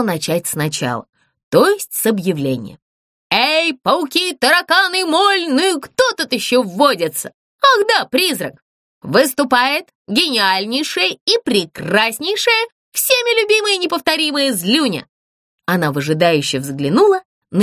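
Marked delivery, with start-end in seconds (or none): 3.3-15.1 a little puppet announcing herself grandly; puppet Zlyunya voice. Acted. Puppet announces herself to the ghost, high and grand.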